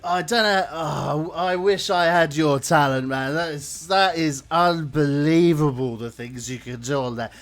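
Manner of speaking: loud, gushing fan voice; booming starstruck praise; enthusiastic fan. Acted. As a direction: gush over the musician with loud delight. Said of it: "He's like".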